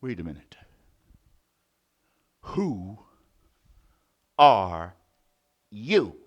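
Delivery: low booming lion voice; gruff lion demand. Acted. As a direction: loom over the mouse. Lion confronts mouse.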